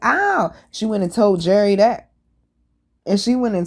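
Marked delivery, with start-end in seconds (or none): 0.0-0.5 high little mock yelp; imitating a whiny yelp. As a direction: squeak it like a wimp. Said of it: "she was like".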